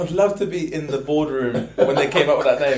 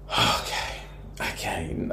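breathy, softened okay; relieved sigh; 8 semitones lower, 9 dB quieter, slower than usual. Acted. Drinker settling after the first sip, relieved and hushed.

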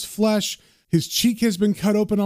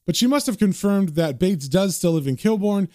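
usual speaking voice

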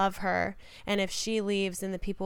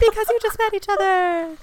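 high, loud mock cheer; hammed-up crowd call, pitched way up; 12 semitones higher, 7 dB louder than usual. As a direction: shout it like an approving crowd. Voicing the cheering crowd's approval.

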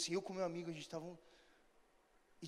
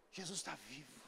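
very soft, low, solemn statement; hushed declaration; 9 semitones lower, 16 dB quieter than usual. Real, not acted. Preacher closing his appeal on Jesus alive, hushed. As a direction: drop to a near-murmur for weight. Hushed close after loud exhortation.

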